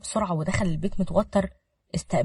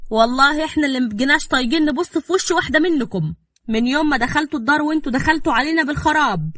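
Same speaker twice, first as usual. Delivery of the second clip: loud, raised-pitch outburst; angry; 7 semitones higher, 6 dB louder than usual. A narrator's reading. Mother-in-law losing her nerve at the girl, angry. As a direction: lose your temper and lash out. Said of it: "فقدت أعصابها وقالت بغضب".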